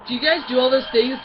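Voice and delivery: loud quoted film dialogue; hammed-up loud question; movie character impression. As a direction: put on a cartoon questioner. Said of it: "that one part in Hotel Transylvania".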